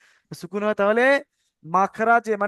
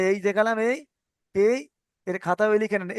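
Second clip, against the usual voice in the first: usual speaking voice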